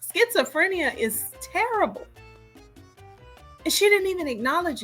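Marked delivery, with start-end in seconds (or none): none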